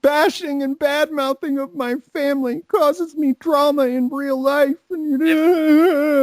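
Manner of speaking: imitating the offended guy, high and tearful; mock whiny victim. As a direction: whine like an offended drama queen. Mocking Nuts whining.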